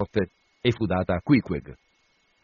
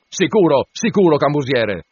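louder, higher shouted retort; 5 semitones higher, 5 dB louder than usual. A narrator's reading. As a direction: yell it at the steward. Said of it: "gridò Stubb".

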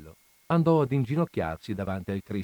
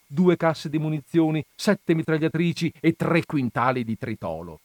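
priest revealing what he knew; 5 semitones higher, 4 dB louder than usual. Acted. Don Camillo tells Peppone about the hidden arsenal, emphatic. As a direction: reel off the list in a higher, insistent voice.

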